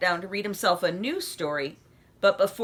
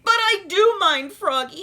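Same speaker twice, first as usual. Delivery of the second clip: exasperated; 10 semitones higher, 7 dB louder than usual. A narrator's reading. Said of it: "he said at last".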